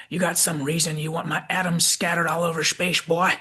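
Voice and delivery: put-on crusty old Southerner with a lifted pitch; Southern McCoy. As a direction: do an old Southern country doctor. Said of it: "picked up some kind of Southern accent".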